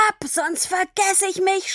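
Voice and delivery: high, furious order; shouting. A narrator's reading. Father sends daughter away, shouting. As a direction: yell the threat, pitched well up. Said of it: "schrie er".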